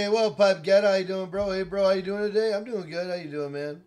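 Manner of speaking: loud, bright hey bro greeting; hyped greeting. Real, not acted. Streamer loudly greeting a friend joining the game. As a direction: greet your buddy big and loud.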